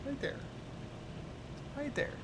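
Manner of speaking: high, sing-song pet voice; high cutesy voice for a kitty. Real, not acted. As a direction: coo up high at the cat. Talking to her cat.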